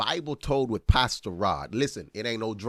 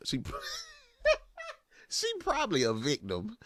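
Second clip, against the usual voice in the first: laughing; 4 dB quieter than usual. Real, not acted. Laughs while pitying wife.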